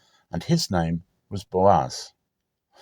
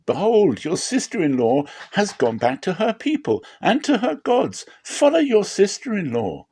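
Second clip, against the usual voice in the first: put-on higher, pleading old mother; grieving Naomi; 11 semitones higher than usual. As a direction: urge them away with heavy sorrow. Naomi urging Ruth.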